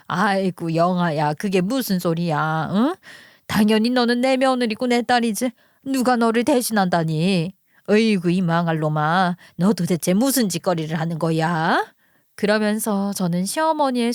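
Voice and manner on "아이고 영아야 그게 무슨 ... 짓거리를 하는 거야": put-on older mother-in-law, fond then furious with son; indignant mother-in-law. Mother-in-law defends daughter-in-law and curses son, indignant.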